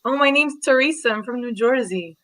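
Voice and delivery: put-on Jersey accent introduction. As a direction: lay on a thick Jersey accent. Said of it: "or a Jersey accent".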